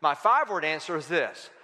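ordinary talk

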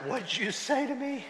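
a hammed-up wounded pride; mock offended. To the eye doctor about readers, mock offended disbelief.

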